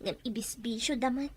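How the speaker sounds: hushed, breathless secret; whispered complaint. Acted. Wife confiding that parents waste her salary, whisper. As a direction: whisper it so no one hears.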